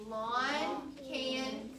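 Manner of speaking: "Lawn can see the hot pot" read very slowly, word by word; teacher leading the class to read a sentence; slow choral reading. Shown as a demonstration.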